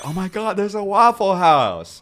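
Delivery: acting out the joy of finding a Waffle House at last; thrilled discovery. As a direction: squeal with joy at a roadside sign. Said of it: "like I'm in the middle of nowhere".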